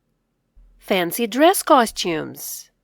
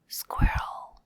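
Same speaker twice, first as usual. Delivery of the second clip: softer whispered echo; 8 dB quieter than usual. Shown as a demonstration. Teacher whispering the word back for kids. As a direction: breathe "Squirrel" very softly.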